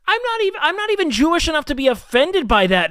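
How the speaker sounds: high, loud and worked up; indignant. Real, not acted. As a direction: protest loudly, still fired up. Indignant retort, voice raised.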